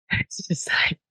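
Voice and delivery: soft breathy giggle through the words; giggling whisper. Retold or imitated. Narrator imitating teammate giggling in the back seat, whispered giggle. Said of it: "Kelsey's giggling in the back".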